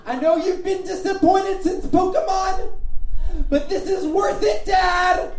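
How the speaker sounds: high, full-volume pleading yell; night-terror shouting. Acted. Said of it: "I was just shouting".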